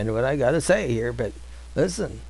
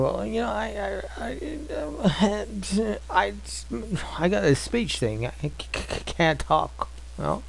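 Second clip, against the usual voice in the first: higher, soft, stuttering Moses; stammering Moses; 5 semitones higher, 7 dB quieter than usual. Retold or imitated. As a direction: stammer out the excuse nervously. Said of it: "he's saying".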